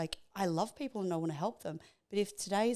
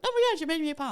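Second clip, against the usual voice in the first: much higher and louder, thrilled; excited husband; 9 semitones higher, 7 dB louder, faster than usual. Retold or imitated. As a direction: gush like a delighted husband. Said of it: "walks in the door and like".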